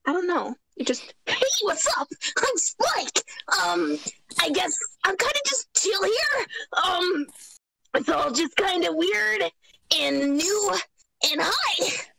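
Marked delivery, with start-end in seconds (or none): 1.2-12.0 put-on much higher, boyish and easygoing; a high boyish voice saying hi; young boy dragon. Shown as a demonstration. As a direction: play a kid dragon who sounds like Ash Ketchum. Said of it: "It sounded like Ash Ketchum".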